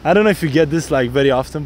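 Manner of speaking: normal talking voice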